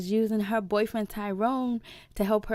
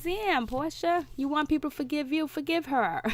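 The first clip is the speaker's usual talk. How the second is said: higher, incredulous call-out at Portia; higher, pitched-up disbelief aimed at Portia; exasperated scolding; 7 semitones higher than usual. Retold or imitated. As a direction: scold her like you can't believe it. Her own reaction to Portia.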